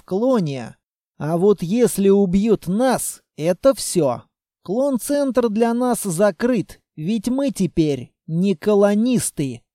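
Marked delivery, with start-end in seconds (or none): none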